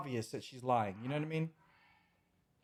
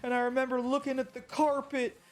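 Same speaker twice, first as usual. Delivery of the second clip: higher, mock-tearful victim voice; mocking fake crying; 8 semitones higher than usual. Vlogger mocking Amber's courtroom testimony, higher mock-tearful voice. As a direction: parody her testimony as fake sobbing without tears. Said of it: "crying with no tears, she's like".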